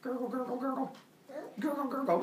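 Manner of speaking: high little gurgle noises; gurgling tummy. Retold or imitated. Kronos's stomach starts to hurt, high mock gurgling. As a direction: imitate a churning tummy in a high voice. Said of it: "gets a little gurgle tummy going".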